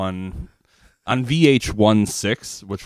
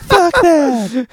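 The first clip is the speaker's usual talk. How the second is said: very high, loud mock cry; wished-for singer imitation; 8 dB louder than usual. Teasing the singer about his wished-for sound, high loud imitation. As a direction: shriek it as a hammed-up impression. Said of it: "he wishes he sounded like".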